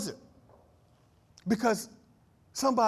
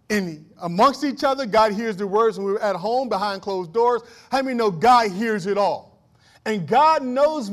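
louder, rising preacher's cadence driving each line home; preaching at full force; 3 semitones higher, 7 dB louder than usual. Acted. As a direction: preach it hard to the congregation.

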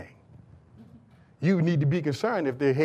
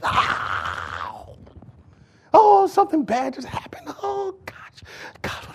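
hammed-up high-pitched panic; mock distraught wail; 13 semitones higher, 4 dB louder, slower than usual. Mimicking people bent out of shape, overdramatic wailing. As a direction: wail as if it were a catastrophe. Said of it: "all just bent out of shape".

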